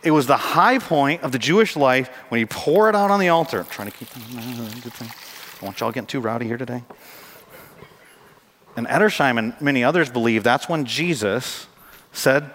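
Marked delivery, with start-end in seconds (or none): 3.6-6.8 much softer, low-key aside; quiet aside. Real, not acted. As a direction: drop the volume for an offhand remark. Quiet joking aside to congregation.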